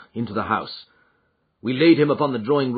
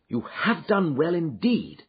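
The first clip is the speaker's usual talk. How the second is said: warm, eager praise, slowly; a touch of excited praise; enthusiastic; 6 semitones higher, slower than usual. Acted. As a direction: praise him with real enthusiasm. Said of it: "cried Holmes with enthusiasm".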